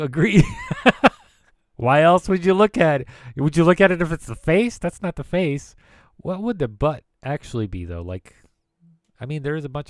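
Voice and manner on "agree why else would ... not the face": laughing. Real, not acted. Laughing at computer joke.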